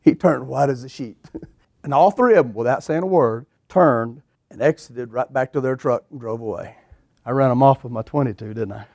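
ordinary talk

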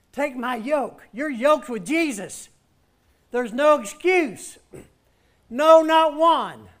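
high, loud preaching, building to a shout; fiery preaching. Acted. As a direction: preach it full force to the congregation. Preaching at full force.

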